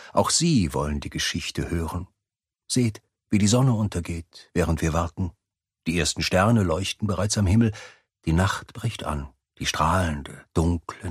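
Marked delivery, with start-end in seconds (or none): none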